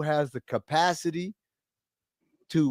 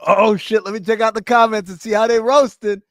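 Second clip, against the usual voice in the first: louder, higher, excited exclamation; eager reaction; 6 semitones higher, 7 dB louder than usual. Retold or imitated. Himself spotting a video to check for roasts, eager. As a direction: act out an eager "oh, shit".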